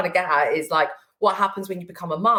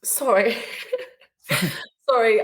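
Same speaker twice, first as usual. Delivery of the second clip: tearful laughing apology; 4 semitones higher than usual. Real, not acted. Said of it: "I'm just getting emotional".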